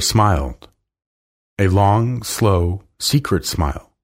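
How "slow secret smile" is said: plain reading voice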